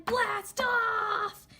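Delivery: high, bright launch cry; excited shout. Shown as a demonstration. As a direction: send them off with a bang. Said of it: "make it sound so much fun".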